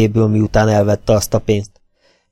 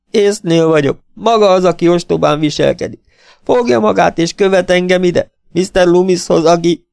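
put-on higher, sharp retort from a young woman; angry sister; 10 semitones higher, 5 dB louder than usual. A narrator's reading. Lila accusing the detective of following her, higher and sharp. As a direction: throw it back at him.

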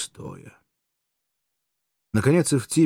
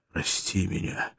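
whisper, slow, pleading; whispered plea; 5 dB quieter, slower than usual. Acted. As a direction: beg forgiveness in a whisper. German begs forgiveness.

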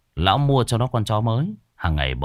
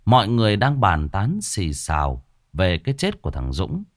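ordinary delivery

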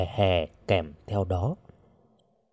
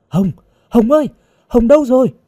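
high, loud, frantic calling; panicked shout; 12 semitones higher, 6 dB louder, faster than usual. A narrator's reading. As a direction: cry out in alarm for a missing friend. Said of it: "Cô hốt hoảng la to". (hốt hoảng la to)

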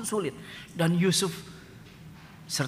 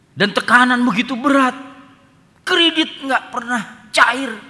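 preaching at full force; 11 semitones higher, 10 dB louder than usual. Real, not acted. Preacher crying out life's burdens to the congregation at full force. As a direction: hammer each hardship home.

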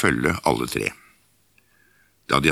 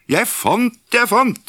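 high, loud, excited cry of a find; excited call; 3 dB louder, slower than usual. Acted. Askeladden calling his find to his brothers, high excited shout. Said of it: "ropte han".

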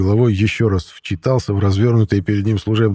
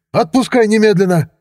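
much higher, aggressive order; yelling; 11 semitones higher, 3 dB louder than usual. A narrator's reading. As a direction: demand release in a raised voice. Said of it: "заорал он". (кричать, требуя подчиниться)

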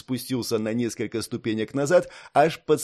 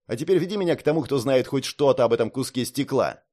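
angry shout. Acted. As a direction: bellow it, fed up. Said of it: "он не выдержал и закричал".

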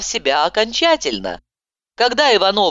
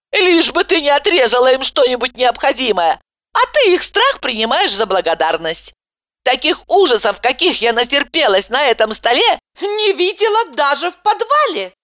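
indignant rant; 8 semitones higher than usual. A narrator's reading. Patient complains of the horrors on the table. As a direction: pile on the grievances. Heroine keeps ranting.

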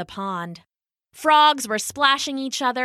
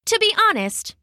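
ordinary delivery

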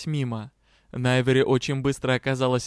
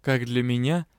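ordinary delivery, nothing marked

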